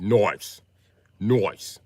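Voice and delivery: "Nice" drawn out as "noice", loud, mock British; hammed-up Englishman saying "Nice"; mock British accent. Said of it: "You from England".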